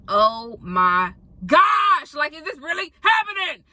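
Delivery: mock-dramatic cry of disbelief, very slow start; exasperated outcry. Traveler learns flight lands too late, exasperated outcry.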